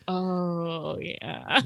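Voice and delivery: low, soft, unbothered; unimpressed. Retold or imitated. Neighbor Stevie shrugging off the Santa news, soft. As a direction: shrug it off quietly. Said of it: "And Stevie's like".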